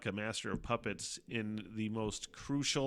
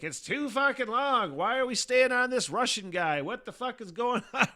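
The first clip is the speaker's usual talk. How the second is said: high, loud mocking network suit; mock TV executive; 13 semitones higher, 7 dB louder than usual. Mocking the network suits who'd cut the show, high and loud. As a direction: sneer at the show like a clueless executive, pitched way up. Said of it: "the fucking suits would be like".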